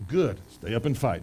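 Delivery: low, soft mock preacher voice. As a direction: lightly play the preacher giving bad advice. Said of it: "That's what the preacher said".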